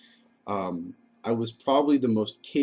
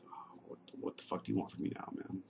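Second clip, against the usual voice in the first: exasperated whisper under the breath; whispered inner thought; 2 semitones lower, 14 dB quieter, faster than usual. Retold or imitated. To himself, seeing his brother out front: exasperated whisper.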